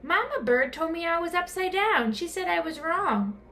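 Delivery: put-on a confused child repeating what she was told; puzzled young bat. A narrator's reading. Stellaluna confused speaks.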